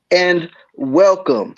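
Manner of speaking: slow stretched announcer welcome; drawn-out welcome. Acted. Show intro, drawn out welcome.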